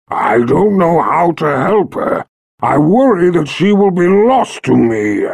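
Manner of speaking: slow, loud grieving dragon voice; a great beast mourning its mother; sorrowful dragon. Acted. Dragon voice held.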